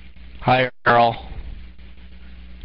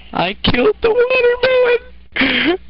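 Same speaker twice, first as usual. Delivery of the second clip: gleeful high cry; much faster than usual. Acted. Gleeful about killing watermelon.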